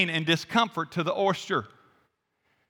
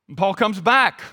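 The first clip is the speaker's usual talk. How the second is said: ordinary talk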